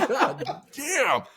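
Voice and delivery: laughing in disbelief at his soaked pants; laughing curse. Retold or imitated. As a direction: crack up while cursing. Laughing at own sweat story.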